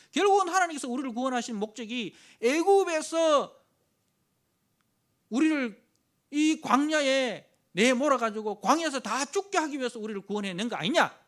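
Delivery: loud, high, scornful accusation against God; the people railing at God in the desert; bitter accusation. Retold or imitated. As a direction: shout the grievance with contempt.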